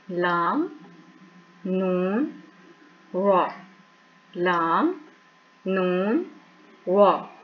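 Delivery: लाम, नून, वा said slowly one by one; letter drill. Shown as a demonstration. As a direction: recite the letters carefully. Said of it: "तो ये word अदा होते हैं".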